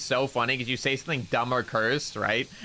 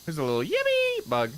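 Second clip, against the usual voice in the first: yippee bug voice; 5 semitones higher than usual. Pretend.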